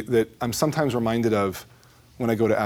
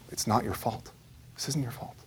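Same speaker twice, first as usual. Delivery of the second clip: soft insistence; 10 dB quieter than usual. Retold or imitated. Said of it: "And he says it again".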